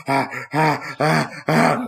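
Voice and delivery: imitated dog panting; dog panting imitation. Imitating the dog panting after licking itself, quick mock pants. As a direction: pant like a tired dog. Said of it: "He's like".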